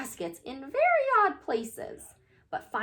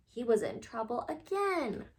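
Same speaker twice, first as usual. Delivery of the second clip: plain reading voice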